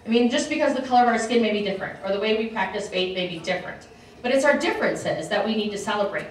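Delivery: ordinary talk, nothing marked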